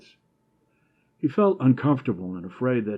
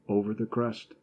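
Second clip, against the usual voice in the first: usual speaking voice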